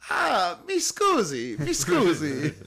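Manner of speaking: high-pitched mock-Italian apology; movie train passenger. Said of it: "Euro Trip when the guy's on the train".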